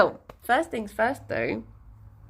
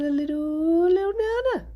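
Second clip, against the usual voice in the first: put-on baby talk; 8 semitones higher than usual. Baby talk about banana treat.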